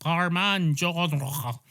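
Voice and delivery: slow, drawn-out French accent. Shown as a demonstration. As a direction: make the name sound grandly French. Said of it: "you made it sound French".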